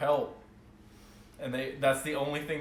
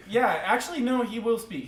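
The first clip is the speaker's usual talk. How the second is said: ordinary talk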